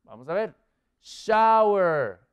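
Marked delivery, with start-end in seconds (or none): 1.0-2.2 SHOWER loud and high, falling away; emphatic model word. Shown as a demonstration. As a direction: call out SHOWER as the answer. Teacher gives the review answer. (palabra modelo, fuerte y enfática)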